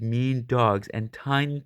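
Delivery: ordinary delivery, nothing marked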